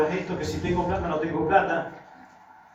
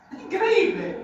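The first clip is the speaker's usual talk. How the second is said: amazed; 13 semitones higher, slower than usual. Real, not acted. Amazed at the answer.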